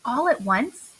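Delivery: ordinary delivery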